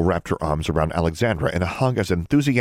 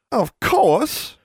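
very high, emphatic delight; delighted high exclamation; gushing; 3 dB louder than usual. Acted. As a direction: gush it as the bubbly sister. Julia gushes in reply.

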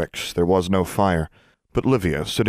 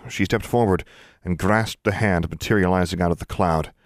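ordinary delivery, nothing marked

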